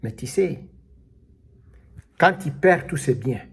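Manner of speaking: ordinary talk, nothing marked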